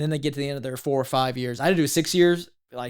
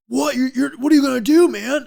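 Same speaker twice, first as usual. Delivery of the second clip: loud, high, stammered disbelief; mock freak-out; 12 semitones higher, 9 dB louder than usual. Shipmates told he's getting out, acted loud alarm. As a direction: imitate a flabbergasted buddy. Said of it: "like, freaking out".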